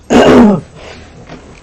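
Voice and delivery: loud chesty cough. Real, not acted. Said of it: "Sorry about that".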